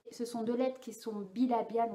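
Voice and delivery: usual speaking voice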